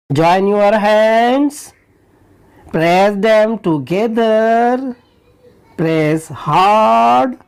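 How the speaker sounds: drawn-out slow commands; slow drill. Shown as a demonstration. Teacher drilling kids through hand presses. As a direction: stretch each word out. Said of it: "teacher repeating action drill".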